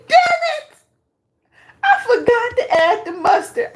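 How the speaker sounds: high, shouted mock frustration; loud laughing imitation, pitched high; mock exasperated cry. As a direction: ham up her cursing at the forgotten mustard. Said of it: "she said".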